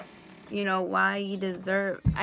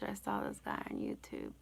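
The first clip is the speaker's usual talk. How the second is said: shocked whisper; 9 dB quieter than usual. Retold or imitated. Shocked reaction to caller's photo.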